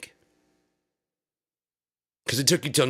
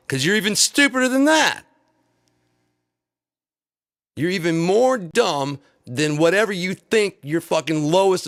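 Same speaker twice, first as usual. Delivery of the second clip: yelling, higher and louder; furious rant building to a scream; angry shout; 7 semitones higher, 5 dB louder than usual. Real, not acted. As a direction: blast the insult at them. Ranting insult at supporters.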